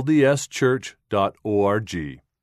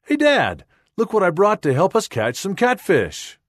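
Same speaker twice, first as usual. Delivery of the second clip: high, loud call to Dad; pitched way up with excitement; excited exclamation; 8 semitones higher, 4 dB louder than usual. A narrator's reading. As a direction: read it as an eager, excited daughter. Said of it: "She excitedly exclaims".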